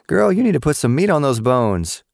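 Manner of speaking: high, teasing lunch lady voice; a lunch lady ribbing a kid, high and teasing. A narrator's reading. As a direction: needle the skinny girl with a high, playful tease. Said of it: "one of the lunch ladies teased".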